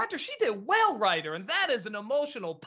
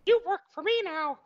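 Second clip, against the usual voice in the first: higher put-on mouse voice; cartoon mouse boss; 7 semitones higher, 4 dB louder than usual. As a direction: play a cartoon mouse taking charge. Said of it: "the mouse's hand was all over it".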